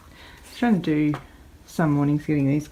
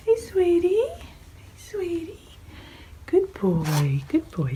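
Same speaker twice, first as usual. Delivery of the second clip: cooing; 12 semitones higher, slower than usual. Real, not acted. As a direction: coo up high at the bunny. Cooing at rabbit.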